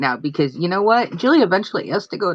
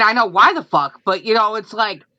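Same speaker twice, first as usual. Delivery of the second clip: ordinary talk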